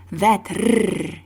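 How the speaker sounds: rrr trilled at the tongue tip; rolled r. Shown as a demonstration. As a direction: roll the rrr hard. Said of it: "that's how it sounds".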